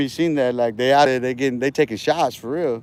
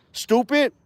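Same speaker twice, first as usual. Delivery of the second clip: mocking insult; 8 semitones higher, 4 dB quieter than usual. Real, not acted. Insulting a commenter in chat.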